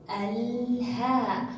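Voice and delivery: "الهاء" drawn out slowly, ل held long; pronounced lam. Shown as a demonstration. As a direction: stretch الهاء, holding the L before a long haa. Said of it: "وهو من حروف اللام القمرية".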